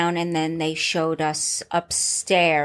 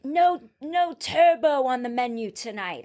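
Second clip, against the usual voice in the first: imitating an English chef, pitched up; English-accent chef laying down the menu; mock English accent, higher; 4 semitones higher than usual. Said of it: "Was that your English accent".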